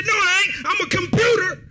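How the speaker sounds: high, loud, half-crying protest; panicked yell. Retold or imitated. Fleeing soldier shouting back at the man in the window, high and half-crying. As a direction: wail it back while running away. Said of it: "I said".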